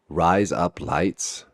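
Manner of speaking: slow, drawn-out words that pass for Australian; Australian accent demo. Shown as a demonstration. Vlogger showing an Australian accent trick, slow Australian accent. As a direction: say RISE UP LIGHTS slowly so it sounds like Australian "razor blades". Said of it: "it sounds like razor blades".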